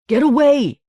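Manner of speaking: loud, high urgent shout. Acted. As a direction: yell to drive them off. Peter shouts in a struggle.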